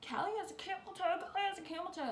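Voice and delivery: mock taunt. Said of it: "being like".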